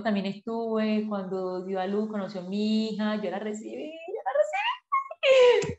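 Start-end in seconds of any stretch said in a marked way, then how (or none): 3.9-5.8 much higher, slow, crying with joy; voice breaking into tears. Real, not acted. Recalling receiving her daughter at birth, crying with emotion. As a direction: let the tears break through. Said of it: "¡Qué emoción!".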